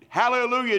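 high, loud praise cry; shouted hallelujah. Real, not acted. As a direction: burst out in praise. Exclamation of praise.

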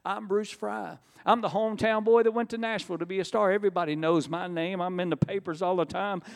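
high-pitched, indignant self-importance; proud protest. Retold or imitated. Hometown star too proud to walk the aisle, proud protest. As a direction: lift the voice and insist on your fame.

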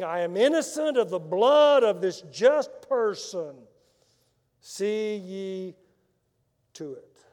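loud, slow, solemn Bible reading; scripture reading. Acted. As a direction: proclaim the verses to the congregation. Reads scripture aloud.

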